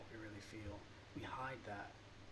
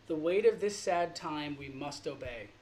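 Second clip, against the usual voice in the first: louder, declaimed with full weight; dramatic Shakespeare recitation; 7 dB louder than usual. Acted. Reciting the end of King Lear to viewers, declaimed. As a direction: proclaim the line like on a stage. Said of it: "like the end of King Lear".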